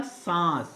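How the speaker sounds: सांस with a long nasal आ; सांस, nasalized and held; nasal vowel. Shown as a demonstration. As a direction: hum the vowel of सांस through the nose. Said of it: "यहाँ पे किसकी आवाज़ आती है".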